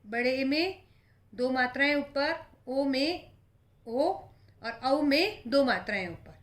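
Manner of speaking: ordinary delivery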